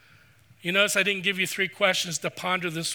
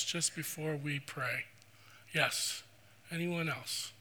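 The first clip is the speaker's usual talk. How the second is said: hushed, gentle invitation to come forward; soft altar call; 2 semitones lower, 5 dB quieter than usual. Acted. Pastor inviting the congregation to raise hands, hushed and gentle. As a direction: speak softly to bowed heads, inviting a response.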